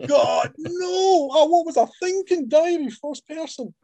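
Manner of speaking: hammed-up anguish at his own mistake; mock despair. Author groaning over a first-person diary, mock wail. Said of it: "mock despair at own choice".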